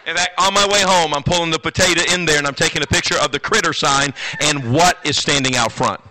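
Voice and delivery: loud, high preaching voice; fired-up sermon delivery. Acted. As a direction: preach it out to the crowd. Preacher opening at full force.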